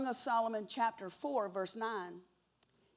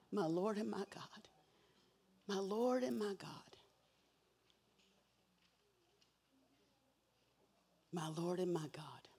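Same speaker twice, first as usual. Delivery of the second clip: whispered, fading ever softer in awe; awed whisper; 4 dB quieter than usual. Acted. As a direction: breathe it like a stunned disciple. Thomas in awe, repeated.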